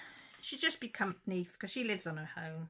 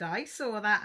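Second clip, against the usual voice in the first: playful tease; 4 semitones higher, 12 dB louder than usual. Retold or imitated. Teasing aunt about the man.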